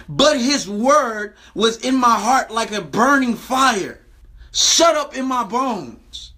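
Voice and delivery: slow, drawn-out scripture delivery; slow, solemn emphasis on the burning fire; slow, weighty reading. Acted. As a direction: let each word land slowly like a sermon climax.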